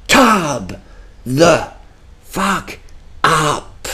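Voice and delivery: CARB... THE... FUCK... UP, word by word; each word of "Carb the Fuck up" on its own; emphatic spacing. Real, not acted. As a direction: pause hard between Carb, the, Fuck and up. Emphatic advice after game over.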